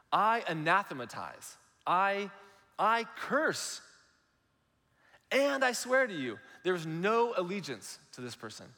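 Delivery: high, forceful oath with weighty pauses; severe sworn denial. Acted. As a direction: swear it off as if cursing someone. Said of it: "illustrates exactly how severe he's".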